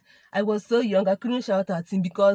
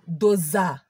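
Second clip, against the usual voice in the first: "Doza" punched hard with a falling thud; slap sound word; 3 semitones lower than usual. Retold or imitated. Slap sound on her face, "Doza" hit hard. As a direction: smack out "Doza" like a slap landing. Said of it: "Do you know what is "Doza".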